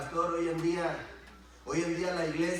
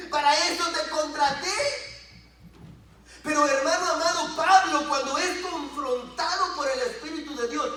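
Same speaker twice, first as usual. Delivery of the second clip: loud, high preacher's cry; full-force preaching; 10 semitones higher, 7 dB louder than usual. Real, not acted. Preacher demanding total surrender to the Lord, full-force preaching. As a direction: hammer it home at raised pitch.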